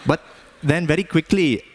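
put-on Indian accent, lifted for the comic tale. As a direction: tell it in Indian-accented English. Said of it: "have an Indian accent anymore".

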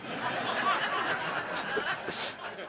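airy high laugh at own dad joke; unvoiced chuckle; laughing at own pun. Real, not acted. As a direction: break into a breathy laugh.

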